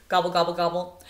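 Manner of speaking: gobble like a turkey; playful turkey imitation.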